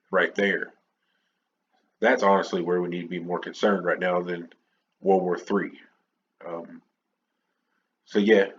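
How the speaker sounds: usual speaking voice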